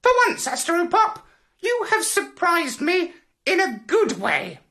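very high parrot character voice; parrot squawk. A narrator's reading. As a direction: squawk out grudging praise as a parrot. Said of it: "squawked the parrot".

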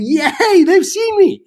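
high, loud gleeful yelp; sudden jubilant shout, way up high; excited cheer. Retold or imitated. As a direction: cheer like you've just been spotted. Retold joyful cry in the water.

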